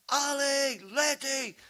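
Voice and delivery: loud, high calls of the names; hollering. Retold or imitated. Grandfather hollering down the hollow for the runaway kids, loud and high. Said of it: "I'm hollering out in the holler".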